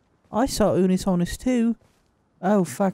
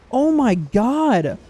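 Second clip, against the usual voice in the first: ordinary delivery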